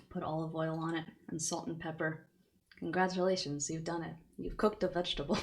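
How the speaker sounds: quiet aside. Real, not acted. Quiet aside about cooking.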